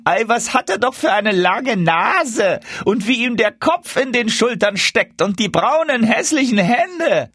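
high-pitched, drawn-out street calls; jeering crowd cries. A narrator's reading. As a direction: call it out slowly and high, gawking at an oddity. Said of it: "Überall hörte er rufen". (hohe, langgezogene Spottrufe)

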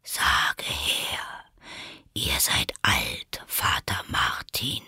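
low, slow whisper; caterpillar. A narrator's reading. The caterpillar orders Alice to recite.

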